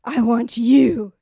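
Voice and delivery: loud, slow hammed-up come-on; a breathy-free mock declaration of desire; mock seductive. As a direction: play it as a pretend flirt at the market. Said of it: "I'll see him and be like".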